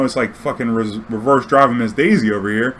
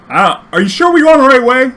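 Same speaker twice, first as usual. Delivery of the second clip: anxious question; 11 semitones higher, 7 dB louder than usual. Retold or imitated. Worried driver questioning passenger.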